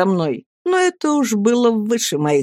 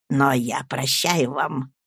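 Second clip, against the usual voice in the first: slow, low and soft; meek forgiveness; 5 semitones lower, 5 dB quieter, slower than usual. A narrator's reading. Humble rival forgives his angry companion, meekly slow. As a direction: draw it out softly and humbly.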